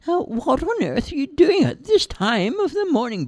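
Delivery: high mother's voice calling down; a mum yelling from the window; high-voiced mum shouting down from upstairs; Charlie's mum. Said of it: "Charlie's mum shouted down".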